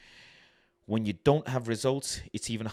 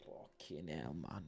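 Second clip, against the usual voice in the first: very soft, low fed-up curse; muttered despair; 7 semitones lower, 16 dB quieter, slower than usual. Real, not acted. Reading the quotes at 3 AM, muttering softly. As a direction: sink it under your breath.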